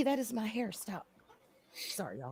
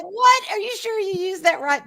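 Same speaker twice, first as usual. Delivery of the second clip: incredulous; 7 semitones higher than usual. Retold or imitated. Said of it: "so I'm like".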